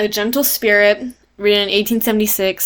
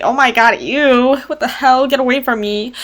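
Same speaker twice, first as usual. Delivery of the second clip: higher-pitched retold revulsion; imitating a repulsed wife; mock disgust; 4 semitones higher than usual. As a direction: recoil in hammed-up disgust. Acted disgusted wife rejecting him.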